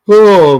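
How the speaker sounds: loud, high groan of exhaustion; tired groan. Real, not acted. Streamer ready to quit for the night, loud weary groan.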